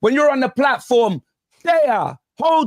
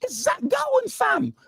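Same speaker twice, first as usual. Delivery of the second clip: high, thin, breaking squeak with the voice almost gone; strained squeaky voice; 7 dB quieter than usual. Real, not acted. Mates laughing as his voice gives out, strained squeak. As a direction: push the words out through a voice that has cracked up. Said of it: "They've taken his voice".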